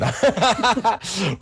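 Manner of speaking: high burst of real laughter; loud, helpless cackle. Real, not acted. Game master hearing of the planned song, loud laugh.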